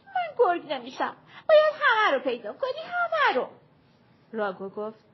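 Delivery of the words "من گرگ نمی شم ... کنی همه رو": put-on very high, loud tearful child; a small child wailing he won't be it; high tearful plea; crying protest. A narrator's reading. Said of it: "شروع کرد به گریه کردن".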